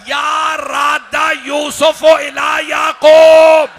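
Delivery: high, slow cries building to a loud, long-held supplication; impassioned majlis preaching. Acted. Hussain asks Laila to pray for their son, impassioned preaching. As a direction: cry the prayer out to the whole gathering.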